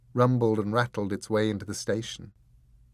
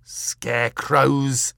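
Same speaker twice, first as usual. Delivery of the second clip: loud, drawn-out, higher cry of disgust; disgusted spitting; 4 semitones higher, 8 dB louder, much slower than usual. A narrator's reading. Croup scorning his partner's guess, spat in disgust. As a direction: spit the word out slowly and loudly. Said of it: "spat Mister Croup, disgusted".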